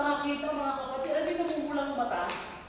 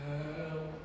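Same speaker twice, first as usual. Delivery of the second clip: possessed voice; 12 semitones lower, 10 dB quieter than usual. Retold or imitated. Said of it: "Ano sabi sa boses".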